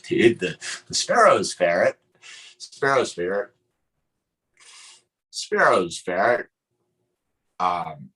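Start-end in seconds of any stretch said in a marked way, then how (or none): 0.1-6.5 hillbilly accent. Shown as a demonstration. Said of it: "I should say it like a hillbilly".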